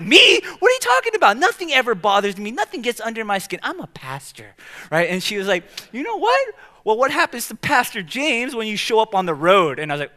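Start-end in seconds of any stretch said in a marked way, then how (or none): 0.0-4.5 much higher and louder, outraged denial; indignant disbelief. Retold or imitated. 5.9-9.8 higher, louder pointed wife's retort; wife calling him out. Retold or imitated.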